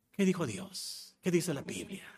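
softly asked question, still voiced; quiet question. Real, not acted. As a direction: ask it softly with a light rise. Repeated question, voiced then whispered.

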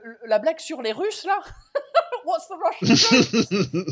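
laughing through the question. Real, not acted. Guest laughing as he asks about the joke.

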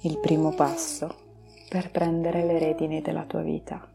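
slow, soft meditation voice; guided meditation. Acted. Leads a relaxation meditation.

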